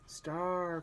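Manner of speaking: a sudden high cry of the name; calling out for his lost horse. Acted. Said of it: "cried Rowan".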